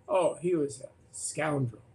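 ordinary talk

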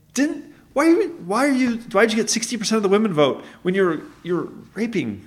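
put-on high-pitched, childlike challenge; high, halting kid-style questioning. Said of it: "I feel like I'm the kid".

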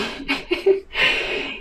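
a high, breathless, airy laugh at the memory; breathy laugh. Real, not acted. Telling the birth story, she breaks into a high airless laugh.

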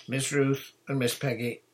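an understated plea called out; begging. A narrator's reading. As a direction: appeal to them for a favour. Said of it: "he begged".

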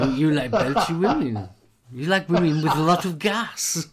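teasing. Real, not acted. Teasing co-host about burps.

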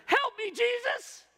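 high, slow, loud cry for help; mock desperate cry. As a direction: cry out to Jesus in a hammed-up plea. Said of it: "you've heard me preach. I'm all into this prayer".

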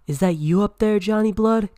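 high, loud call up to someone; crying out. Acted. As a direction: holler it as a startled question from below. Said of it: "cried the teammate".

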